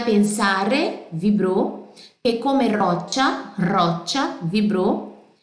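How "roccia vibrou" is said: normal talking voice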